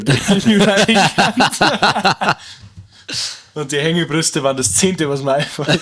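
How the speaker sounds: words squeezed through laughter; laughing. Real, not acted. Laughing at his own joke.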